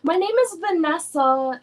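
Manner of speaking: fake Vanessa persona. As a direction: lightly play a made-up girl on the phone. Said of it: "So I'm like".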